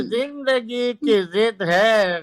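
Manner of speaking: stately nazm recitation. Acted. Reciting sher at mushaira.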